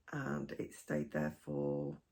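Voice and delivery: rough croaky throat, slow and low; hoarse, croaky voice catching. Real, not acted. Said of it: "sorry, croaky voice".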